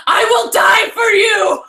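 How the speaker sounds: high, loud, slow dramatic shout; devoted dog. Acted. Streamer voicing a dog from a tweet, high slow shouted devotion. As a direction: bellow it like a dog swearing eternal loyalty.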